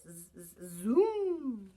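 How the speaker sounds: lawnmower zoom. Shown as a demonstration. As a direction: grow the zoom like a mower revving. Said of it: "It goes like this".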